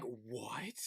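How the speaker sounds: breathless unvoiced whisper of shock; whispered what. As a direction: gasp the word out in a whisper. Stunned reaction while watching show.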